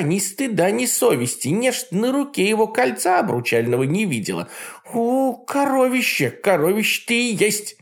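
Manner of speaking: high mocking old witch; Baba Yaga voice. A narrator's reading. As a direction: sneer like a jealous hag. Yaga scolding.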